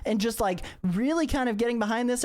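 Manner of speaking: high, bubbly fangirl voice, words tumbling out; a starstruck teen hero gushing over her idol; gushing Kamala Khan. Acted. Starstruck Kamala gushing to Dragonus, high and bubbly.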